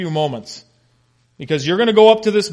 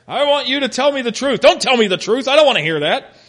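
mock indignant; 8 semitones higher, 4 dB louder than usual. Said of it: "this mixed message that Ahab is sending".

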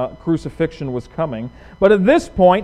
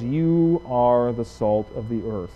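"You" slow and pressed, quieter line; emphatic you; 6 semitones lower, 6 dB quieter than usual. Shown as a demonstration. Preacher quoting Jesus to the disciples, stressing you. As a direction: linger on "You" slowly. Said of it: "he emphasizes the who, the you".

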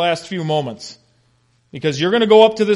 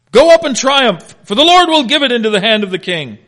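high, loud mock-triumphant proclamation; sarcastic; 7 semitones higher, 8 dB louder than usual. Prophet mocking the other prophets to the king, sarcastic. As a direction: proclaim it big, with false enthusiasm. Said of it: "This is sarcasm".

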